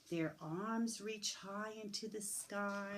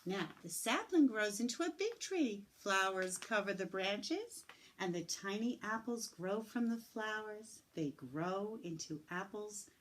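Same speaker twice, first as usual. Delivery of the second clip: ordinary talk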